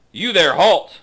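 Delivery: high, loud challenge; commanding shout. Acted. Guard challenging approaching travelers, commanding shout. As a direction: call out sharply to halt them.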